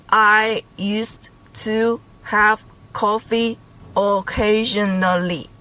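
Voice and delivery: very slow, separated words. Shown as a demonstration. Teacher showing learners an English example, word by word. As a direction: spell the sentence out slowly, piece by piece.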